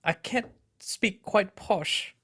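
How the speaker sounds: Queen's English accent. Shown as a demonstration. Co-host asked for Queen's English, slow posh British attempt. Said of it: "can you speak in the Queen's English".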